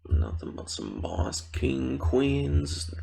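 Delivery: high, drawn-out mock-grand praise; playful hype. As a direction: hail the listeners with stretched high notes. Playfully hailing the listeners.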